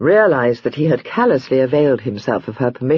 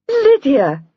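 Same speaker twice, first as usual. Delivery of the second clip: higher and louder, a surprised gasp; 12 semitones higher, 4 dB louder than usual. A narrator's reading. Jenny gasps as Lydia arrives unexpectedly. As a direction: gasp her name in disbelief. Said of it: "gasped Jenny".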